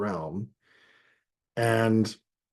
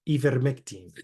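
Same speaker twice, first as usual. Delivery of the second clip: put-on ivermectin said the Spanish way, ee-ver-mek-teen; Spanish vowels on ivermectin; lightly done Spanish accent. Shown as a demonstration. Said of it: "in Spanish".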